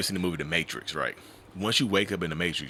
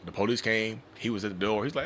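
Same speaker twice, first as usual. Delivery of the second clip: ordinary talk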